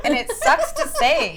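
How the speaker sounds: laughing through the words; words breaking into a high laugh. Real, not acted. Laughing at her own story.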